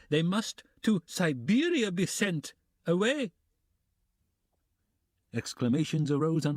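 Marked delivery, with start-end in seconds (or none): 0.1-3.3 high indignant landlady; shooing out a thief, higher pitched; mild mock shout. Amalia banishing Sonia, high and indignant.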